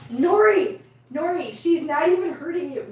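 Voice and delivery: high, protesting reproach, loud on the name; exasperated pet scolding. Real, not acted. Carer to dramatic cat, exasperated scolding. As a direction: call the name sharply, then reason in a high voice.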